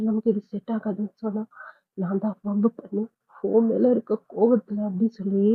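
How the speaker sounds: usual speaking voice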